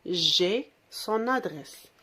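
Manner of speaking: slow, careful model sentence; model answer. Shown as a demonstration. Teacher to pupils, modelling the answer slowly. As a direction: spell it out slowly for the class. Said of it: "Qu'est-ce qu'il a répondu".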